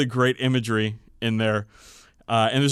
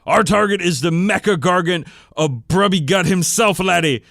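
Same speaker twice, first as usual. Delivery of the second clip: gruff pack leader; 6 semitones higher, 7 dB louder than usual. Acted. Pack leader voiced in story.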